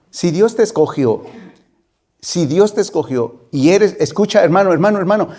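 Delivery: ordinary talk, nothing marked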